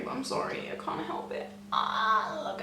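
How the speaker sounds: sheepish hushed confession; guilty whisper. Real, not acted. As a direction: whisper it like you're sneaking a treat. Guiltily swatching despite promising not to.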